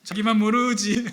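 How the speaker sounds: chuckling through a teasing jab; laughing, a knowing tease; laughing teasingly. Real, not acted. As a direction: tease them while laughing. Teasing punchline to congregation.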